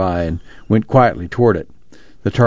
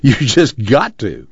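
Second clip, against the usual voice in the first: slow, amused insistence; gently teasing, slow and amused; happy chuckle; slower than usual. A narrator's reading. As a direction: draw it out with a touch of happy chuckle. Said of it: "Tom chuckled happily".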